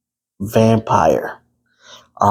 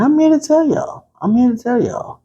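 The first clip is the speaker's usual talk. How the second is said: much higher excited announcement; mock-grand announcement, much higher; announcing; 12 semitones higher than usual. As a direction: proclaim it with big showman energy. Dramatic announcement.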